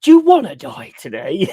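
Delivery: high, loud mock threat with a laugh; mock glam rocker threat. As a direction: ham up a glam rocker picking a fight. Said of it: "turning around going".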